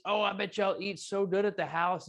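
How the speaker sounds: lightly drawn Arkansas twang on y'all; quoting folks in a bit of Arkansas accent. Retold or imitated. Host quoting what friends ask him, mild Arkansas accent. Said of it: "that Arkansas accent coming out".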